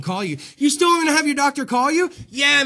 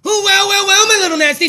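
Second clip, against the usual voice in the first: hyped intro; 9 semitones higher, 9 dB louder, faster than usual. Real, not acted. Hyped show opening greeting.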